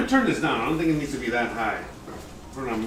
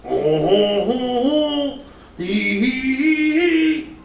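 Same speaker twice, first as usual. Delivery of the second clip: high hammed-up ho ho hee hee; mock laugh climbing higher; cackle; 9 semitones higher, 5 dB louder, much slower than usual. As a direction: cackle like a cartoon villain. Mock laughing while cooking.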